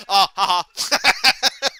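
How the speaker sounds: quick loud laugh of a fan at the wreck; gleeful laugh. Retold or imitated. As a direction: cackle as the rival crashes. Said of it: "one of the guys goes".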